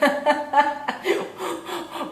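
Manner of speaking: high amused laugh. Real, not acted. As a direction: burst out laughing at the absurdity. Laughing at pasta song.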